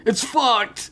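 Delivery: high-pitched hammed-up outburst; comic high despair; mock breakdown. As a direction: imitate a man cracking, voice jumping up. Acted breakdown of Joe.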